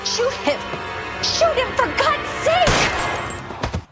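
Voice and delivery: high desperate begging; panicked pleading. Acted. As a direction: beg him to shoot, terrified. Panicked woman begging shooter.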